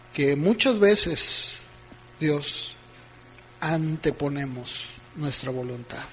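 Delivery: calm, earnest prayer to the Lord; closing prayer. Acted. Pastor praying over the congregation, earnest and calm.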